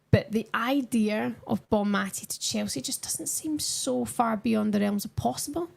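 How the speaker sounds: normal talking voice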